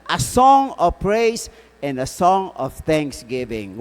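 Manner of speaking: ordinary delivery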